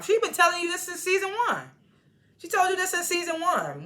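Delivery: high-pitched, insistent protest; indignant. Real, not acted. Indignant argument against Phil.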